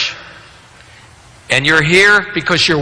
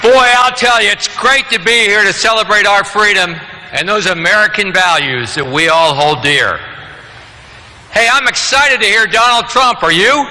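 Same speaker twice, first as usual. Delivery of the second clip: booming motivational speech; 7 semitones higher than usual. Acted. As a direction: whip up the crowd, booming. Rally speaker firing up crowd.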